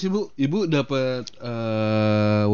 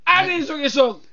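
very high, squealed reproach; hammed-up high-pitched telling-off; mock scolding. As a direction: chide playfully in a high cry. Co-host joins the mock scolding.

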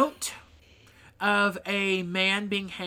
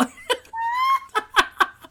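high burst of laughter; 5 dB louder than usual. Real, not acted. Laughing at the punchline.

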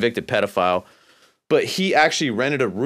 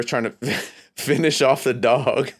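laughing; 2 semitones higher than usual. Real, not acted. Storyteller cracking up at his own dog story, laughing. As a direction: laugh breaking into the words.

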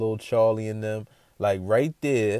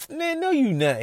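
disbelieving. Retold or imitated. Doubting the stranger's claim.

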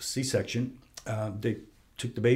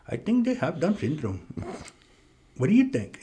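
higher-pitched imitation of an Indian accent; 5 semitones higher than usual. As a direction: imitate the Indian doctor, voice lifted.

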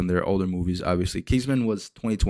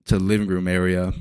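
usual speaking voice

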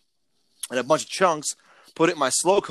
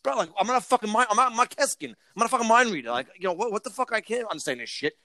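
higher, incredulous venting at a clueless customer; mock fed-up rant, half performed; retold exasperated rant; 7 semitones higher, faster than usual. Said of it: "I'm like".

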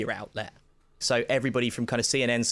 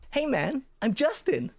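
higher, friendly mock greeting; chirpy star introducing himself; imitating [name]; 12 semitones higher, slower than usual. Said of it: "was like".

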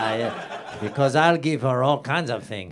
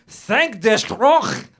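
harsh, slow and higher villain line; 9 semitones higher, 7 dB louder, much slower than usual. Acted. Dubourg greets the frightened girl, harsh and slow. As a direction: spit it out like a cruel libertine. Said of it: "he said harshly".

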